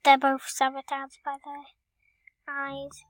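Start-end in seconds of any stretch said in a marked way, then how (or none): none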